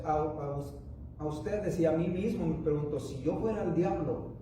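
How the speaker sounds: ordinary talk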